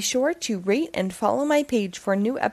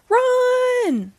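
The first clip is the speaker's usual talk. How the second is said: much higher, urgent shout; panicked shout; 13 semitones higher, 3 dB louder than usual. Acted. Animal urging others to flee, panicked shout. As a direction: yell at them to flee.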